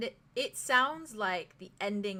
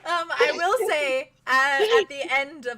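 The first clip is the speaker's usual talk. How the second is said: laughing while talking; 5 semitones higher, 10 dB louder, slower than usual. Real, not acted.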